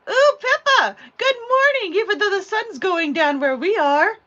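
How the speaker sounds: high, loud delighted hello; excited greeting. Real, not acted. Crafter greeting a viewer who just joined, excited and loud. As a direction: call out a big high-pitched welcome.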